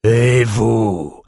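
huge, very slow booming dragon; booming frost giant. A narrator's reading. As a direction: boom it out like an ancient dragon. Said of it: "d'une voix qui fit trembler les rochers".

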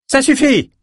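commanding voice stopping the auction; powerful command. A narrator's reading. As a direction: cut the auction off hard. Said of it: "lança une voix puissante".

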